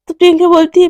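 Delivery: usual speaking voice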